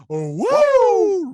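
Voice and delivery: high, loud, stretched-out woo; Ric Flair woo. Retold or imitated. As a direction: let rip a wild wrestler's woo.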